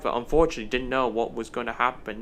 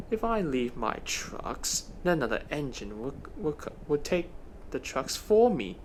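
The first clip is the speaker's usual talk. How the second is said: scheming; 6 dB quieter than usual. Acted. Dennis plotting his naughty idea.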